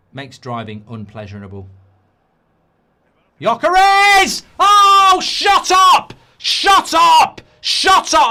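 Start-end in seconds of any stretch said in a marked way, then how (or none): 3.4-7.4 screaming much higher and louder; full-throated celebration yell; euphoric shout. Real, not acted. Celebrating goal, shouting at screen. 7.6-8.3 screaming much higher and louder; full-throated celebration yell; euphoric shout. Real, not acted. Tail of goal celebration.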